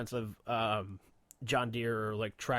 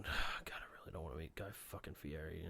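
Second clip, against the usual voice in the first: muttered under the breath, much softer and lower; dad muttering to himself; 7 semitones lower, 11 dB quieter than usual. Retold or imitated. Dad in the car dreading meeting Guy Fieri, muttering under his breath. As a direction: grumble it privately so nobody hears. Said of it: "just going".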